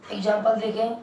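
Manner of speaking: ordinary delivery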